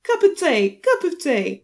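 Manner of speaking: high, hammed-up British accent; over-the-top fake British accent; American's British accent. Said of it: "in an accent like an, like an American would do".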